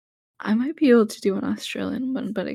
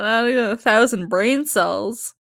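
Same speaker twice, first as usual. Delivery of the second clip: ordinary delivery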